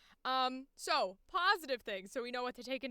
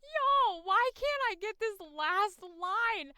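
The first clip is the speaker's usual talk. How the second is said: higher, louder, slowed cry of frustration; exasperated outburst; 7 semitones higher, 6 dB louder, much slower than usual. Real, not acted. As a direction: throw it up in mock despair. Exasperated laughing complaint.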